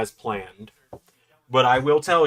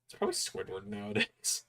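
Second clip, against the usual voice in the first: whisper; 9 semitones higher, 12 dB quieter than usual. Real, not acted. Whispered aside about Squidward.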